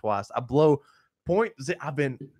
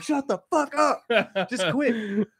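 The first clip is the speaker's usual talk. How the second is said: much higher and louder, barking at him; mock yell; 10 semitones higher, 5 dB louder, slower than usual. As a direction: yell it like a nervous rookie cop losing it. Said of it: "he's yelling at me".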